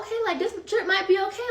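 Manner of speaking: high-pitched hopeful realization; a little excited. Retold or imitated. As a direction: retell growing excitement in a high voice. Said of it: "now I'm getting a little excited".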